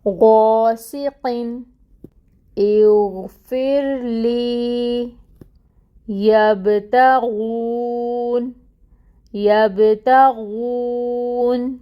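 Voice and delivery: غاسقٍ and یبتغون with a full, heavy غ, very slow; heavy ghain recitation. Shown as a demonstration. Teacher reciting heavy ghain examples slowly. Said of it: "غا نہیں پڑھے".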